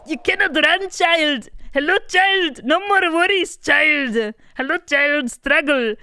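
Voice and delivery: put-on high, loud hungry monster in a German accent; the hungry monster coaxing the child; loud, high mock German accent; German monster impression. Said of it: "Is this my German impression".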